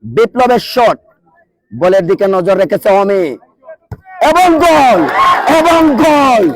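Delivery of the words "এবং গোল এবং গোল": high, ringing commentator's cry; excited goal call. Real, not acted. Commentator celebrating a fine penalty for listeners, high excited shout. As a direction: call the goal out twice, pitched up.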